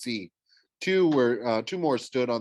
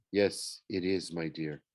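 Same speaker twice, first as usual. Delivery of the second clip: put-on softer, lower voice with a French accent; lightly voiced Frenchman, lower and softer; 5 semitones lower, 5 dB quieter than usual. A narrator's reading. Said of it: "said a man's voice in a heavy French accent".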